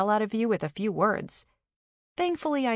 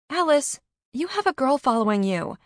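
high, raised exclamation; startled; 8 semitones higher, 4 dB louder than usual. A narrator's reading. Carney spots the girl following Alice, startled. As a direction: point it out in amazement.

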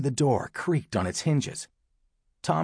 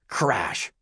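ordinary delivery

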